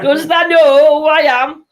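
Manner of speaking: Yorkshire "does the know who I am", high and slow, through laughter; high, slow Yorkshire accent, laughing. Pretend. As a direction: ham up the Yorkshire, cracking up. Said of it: "do your northern accent".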